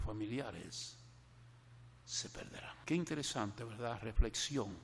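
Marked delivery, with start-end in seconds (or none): 2.0-2.8 breathy whisper, barely voiced; whispered warning. Preacher whispers to his flock that their families will be lost, whispered. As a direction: let it fall to a whisper.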